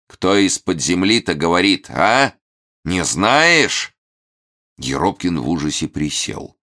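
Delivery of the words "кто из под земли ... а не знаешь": mock evil villain. Hermit menacing.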